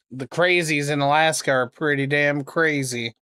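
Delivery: ordinary delivery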